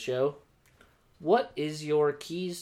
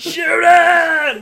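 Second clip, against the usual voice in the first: high, very loud drawn-out shout; mock echoing fan call; 14 semitones higher, 13 dB louder than usual. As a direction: imitate fans calling to a player. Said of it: "there's, like, like, echoing calls of".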